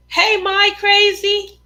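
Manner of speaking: high, slow, stretched-out hail; calling out greeting. Retold or imitated. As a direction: hail a friend by nickname. Acts out someone greeting her.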